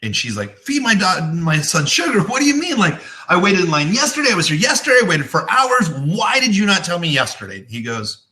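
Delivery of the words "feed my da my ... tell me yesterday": higher, indignant mock complaint from the retold mother; upset mother. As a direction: play the annoyed mother, pitch up in protest. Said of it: "And the mother gets upset".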